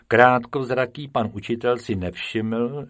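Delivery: usual speaking voice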